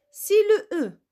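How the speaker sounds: ordinary delivery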